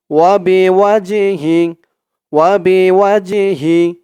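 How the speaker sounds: Wazed chanted slowly; slow recitation. Shown as a demonstration. Teacher reads syllables for students, slow. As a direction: draw out each syllable. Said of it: "তো আমার সাথে বলুন".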